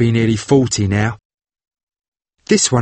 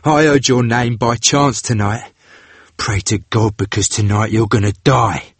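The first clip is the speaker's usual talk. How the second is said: grim vengeful sailor voice; 7 semitones higher than usual. A narrator's reading. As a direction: spit out the long-held grudge. Vengeful brother threatens.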